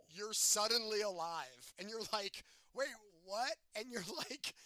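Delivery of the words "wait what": mock bewildered, raised pitch; higher, louder mock confusion; confused. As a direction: imitate a startled double take, voice lifting. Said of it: "so confused".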